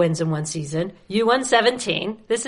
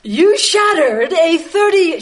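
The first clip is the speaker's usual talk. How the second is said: high, loud, slow proclamation; mock-formal read-out; 6 semitones higher, 6 dB louder, slower than usual. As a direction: boom it out word by word. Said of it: "read it in your most monotonous voice".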